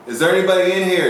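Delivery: high, fast call into an empty house. Retold or imitated. Ghost hunter calls into the dark house, high and fast.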